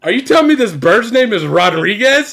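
loud, high disbelief; can't-believe-it retold question; incredulous outburst. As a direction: demand it in loud, high-pitched bafflement. Retelling own baffled outburst.

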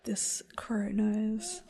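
whisper. Real, not acted. Aside about the crow.

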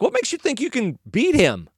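doubtful, high-pitched question; King Saul. Retold or imitated. King doubting the shepherd boy, incredulous. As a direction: challenge him in high disbelief. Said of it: "And Saul's like".

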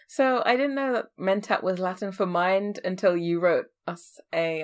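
ordinary delivery

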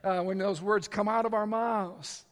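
ordinary talk, nothing marked

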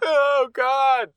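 high squealing laugh on oh God. Real, not acted. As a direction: crack up and squeal it out. Laughing at joke.

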